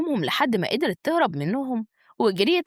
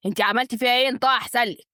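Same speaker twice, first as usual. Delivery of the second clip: ordinary delivery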